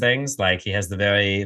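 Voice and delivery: usual speaking voice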